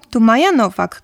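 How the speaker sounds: usual speaking voice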